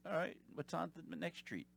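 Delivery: soft, distant forgetful chirp; lightly voiced dog-brained caller; mindless dog. Caller forgets everything at once, like a dog. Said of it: "like a dog mind".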